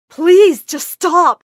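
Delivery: high, loud, desperate plea; pleading yell. A narrator's reading. Amara dragged to the door by Mama, yelling. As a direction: yell for it to stop. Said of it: "I kept yelling at her".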